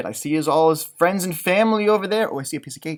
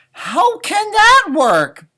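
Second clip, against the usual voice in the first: baffled; 12 semitones higher, 9 dB louder, much slower than usual. Real, not acted. Exasperated question.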